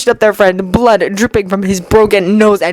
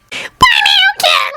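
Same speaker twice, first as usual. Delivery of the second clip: high-pitched nasal voice. Acted. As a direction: squeak it out like a whiny bully. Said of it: "his voice high-pitched and nasally".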